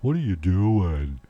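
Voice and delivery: slow, drowsy puzzled dog; sleepy dog voice. Pretend. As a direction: voice a groggy dog woken up. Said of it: "look at you with a sleepy eye like".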